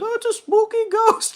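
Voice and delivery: very high, loud, goofy dad voice; Randy impression. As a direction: squeal it like a scared dumb dad. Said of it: "and he's like".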